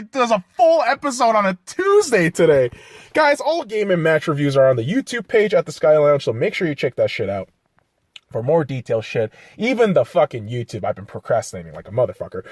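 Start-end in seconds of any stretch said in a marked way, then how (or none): none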